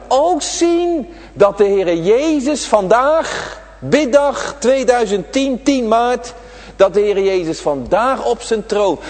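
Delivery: drawn-out pulpit preaching; fervent preaching. Acted. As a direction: stretch each phrase out over the congregation. Preaching, slow and raised.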